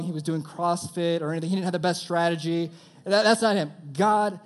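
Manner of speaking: loud, high, fervent preaching. Acted. Preacher urging the youth to trust God, loud and high. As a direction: drive the point home to the crowd.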